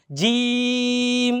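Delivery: জিম stretched very long, slow; drawn-out letter. Shown as a demonstration. Teacher stretches জিম for students to copy. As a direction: hold the জিম long and steady. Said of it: "আমার মুখের দিকে দুই একবার খেয়াল করলেই".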